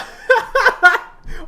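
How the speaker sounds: loud high-pitched cackle; a sudden shrill burst of laughter; high shrieking laugh. Acted.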